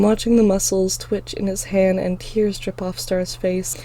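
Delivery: ordinary delivery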